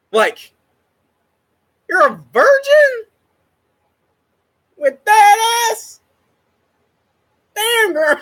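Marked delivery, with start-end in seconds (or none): 1.9-8.2 high squealing teasing voice; hammed-up shocked flirt; mock flirty tease.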